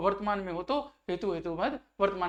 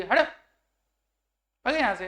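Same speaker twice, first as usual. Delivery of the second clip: contemptuous scolding; 3 semitones higher, 5 dB louder than usual. Shown as a demonstration. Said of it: "ऐसे अपन बोलते हैं ना".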